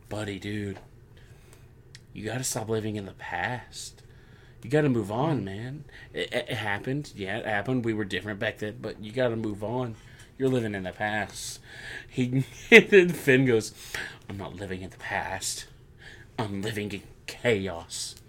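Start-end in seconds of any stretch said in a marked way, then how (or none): 0.1-11.6 hushed, dismissive Seth. Retold or imitated. Seth Rollins telling Finn to move on, softly and dismissively. 14.3-18.2 hushed menacing Finn. Retold or imitated. Voicing Finn's retort.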